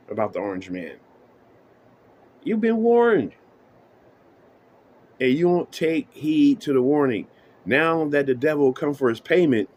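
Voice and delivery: ordinary talk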